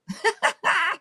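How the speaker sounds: high-pitched burst of laughter; delighted laugh. Real, not acted. Streamer proud of singer in chat, high laugh.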